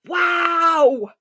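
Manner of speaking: high, loud wow of amazement; awed wow. Real, not acted. Still amazed telling listener about the cougar, awed wow. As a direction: marvel at it out loud.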